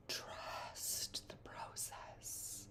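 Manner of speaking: slow hushed whisper. Acted. Spirit whispering to the shaking traveller, slow whisper. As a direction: breathe it like a secret from beyond.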